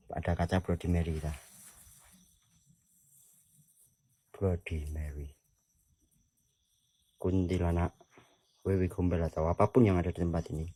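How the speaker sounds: hushed summoning. Acted. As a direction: speak low, as if not to disturb the dark. Quietly calling spirits by a mirror. (suara pelan memanggil arwah)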